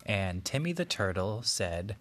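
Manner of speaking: ordinary delivery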